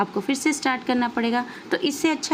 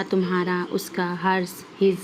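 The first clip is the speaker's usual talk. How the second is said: usual speaking voice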